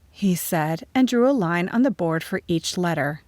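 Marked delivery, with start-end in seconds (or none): none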